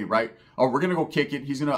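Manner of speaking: usual speaking voice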